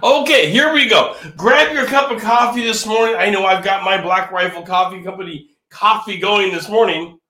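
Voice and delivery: usual speaking voice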